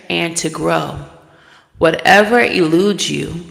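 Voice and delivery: grand, loud inspirational read; oracle card reading. Acted. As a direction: proclaim it like a spiritual message. Reads the card's channel message.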